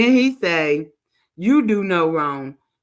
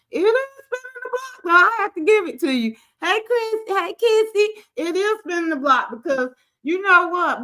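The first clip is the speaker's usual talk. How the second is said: much higher, sassy and taunting the whole way; sassy high rant; 6 semitones higher than usual. Real, not acted. Telling viewers karma is spinning the block, sassy and much higher. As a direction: lay into him with a sing-song taunt.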